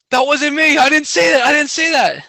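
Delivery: high, loud mock protest; hammed-up frantic disclaimer; panicked denial. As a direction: plead innocence at the top of your voice. Retells panicked denial to rivals.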